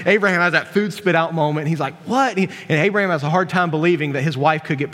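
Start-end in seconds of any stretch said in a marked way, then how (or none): 2.1-2.4 high-pitched startled question; mock disbelief. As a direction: blurt it out in mock shock. Abraham's food spit-out moment.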